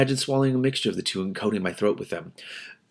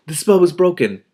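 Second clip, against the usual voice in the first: ordinary delivery, nothing marked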